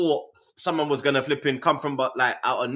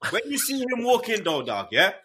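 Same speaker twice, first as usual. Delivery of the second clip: ordinary talk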